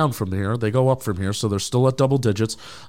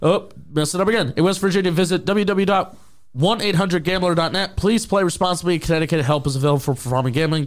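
fast legal disclaimer; 5 semitones higher than usual. Acted. Gambling disclaimer read.

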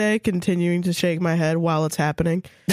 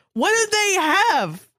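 mock shock; 10 semitones higher than usual. Friend mocking the story, fake shocked high voice. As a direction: pitch it way up in fake disbelief.

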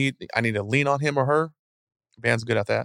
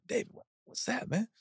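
breathy, hushed and higher, like spotting something odd; hushed question; 12 semitones higher, 12 dB quieter than usual. Retold or imitated. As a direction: whisper it as you peer down at the sand. Spotting footsteps from the helicopter.

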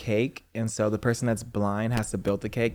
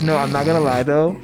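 ordinary delivery

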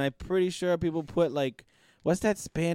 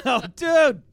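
laughing exclamation; 9 semitones higher, 7 dB louder than usual. Real, not acted. Cracks up before his big line.

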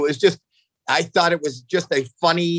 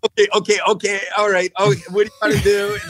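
put-on nervous young comic; 4 semitones higher, faster than usual. Young comic stalling on stage in a flustered, laughing voice. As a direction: play the panicked newcomer fumbling for a bit. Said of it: "And it's me".

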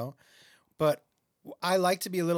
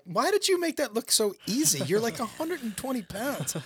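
higher-pitched incredulous complaint; mock protest; 5 semitones higher than usual. Client protesting to a small, strong trainer, mock incredulous. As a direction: whine in playful disbelief at being outdone.

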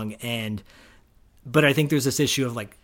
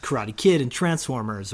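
normal talking voice

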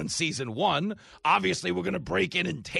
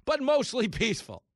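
higher, sing-song mock report; mock news anchor; 6 semitones higher than usual. Host mocking news coverage of riots, higher. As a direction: play the dishonest reporter. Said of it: "that's what we were told".

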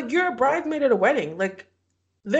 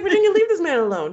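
louder, fast laughing scold; mock scolding; 7 semitones higher, 6 dB louder, faster than usual. Podcaster scolds Virginia on screen, laughing mock scold. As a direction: tell her off through laughter. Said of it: "I was like".

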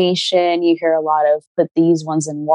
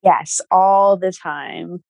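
drawn out, leaning on all; slow emphatic echo; much slower than usual. Real, not acted. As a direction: stretch every word, knowingly.

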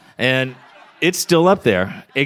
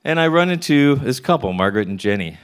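ordinary delivery

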